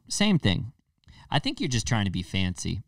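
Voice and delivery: usual speaking voice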